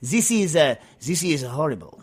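a slightly improved mock French accent; better French accent. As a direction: lean a bit harder into the hammy French accent. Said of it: "That's a little better".

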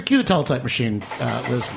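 ordinary talk, nothing marked

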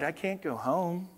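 soft, resigned lament; small, mildly downcast voice; sighing knight. Acted. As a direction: sigh it out quietly. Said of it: "Sir George sighed".

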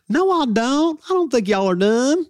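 high, hammed-up Southern drawl; Southern accent. Preacher answering critics in the very Southern accent they mocked. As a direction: deny it in a squeaky put-on Southern accent. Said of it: "it was always a very Southern accent".

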